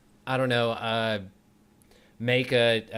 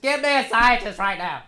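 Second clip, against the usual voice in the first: high, loud hammed-up demand; mock urgent shout; 10 semitones higher, 6 dB louder than usual. Joking with his co-host, a mock urgent shout for a scientist. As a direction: bellow it like a panicked boss.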